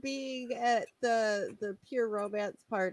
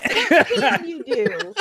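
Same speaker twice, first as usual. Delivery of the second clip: laughing; 8 semitones higher, 12 dB louder, slower than usual. Real, not acted. Teasing friend who can't read.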